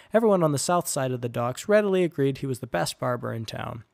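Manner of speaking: plain reading voice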